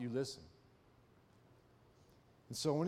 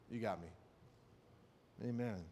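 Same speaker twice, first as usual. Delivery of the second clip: ordinary talk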